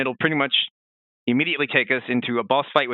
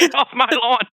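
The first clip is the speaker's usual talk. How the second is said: put-on grumpy old man; 10 semitones higher, 5 dB louder than usual. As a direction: shake your fist at the kids on your lawn. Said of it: "He's like".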